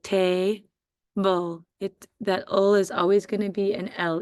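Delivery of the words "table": TA-BLE split by a clear pause; long "ta", then a separate "ble"; syllable by syllable. Shown as a demonstration. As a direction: say ta-ble slowly as two beats. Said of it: "doing the syllables like".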